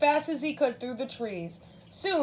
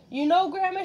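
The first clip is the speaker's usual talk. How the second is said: little Red's higher-pitched, mildly surprised voice; 7 semitones higher than usual. A narrator's reading. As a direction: lift it with a touch of surprise. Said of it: "she said with surprise".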